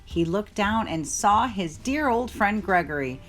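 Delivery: ordinary delivery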